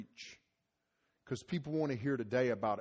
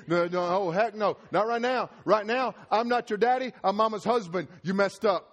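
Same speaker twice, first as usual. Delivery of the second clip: stern dad scolding; 8 semitones higher, 9 dB louder than usual. Retold or imitated.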